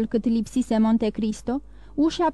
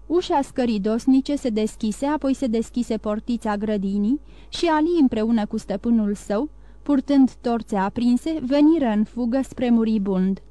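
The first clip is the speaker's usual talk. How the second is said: ordinary delivery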